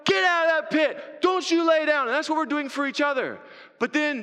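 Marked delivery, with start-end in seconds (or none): 0.0-2.1 high, loud rallying cry; forceful, insistent pleading shout; urging shout. Acted. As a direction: yell down to a friend to get up. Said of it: "when Chance is telling Shadow".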